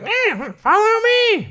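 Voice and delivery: very high-pitched squeaky little mushroom voice; Toad. Acted. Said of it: "Toad's like".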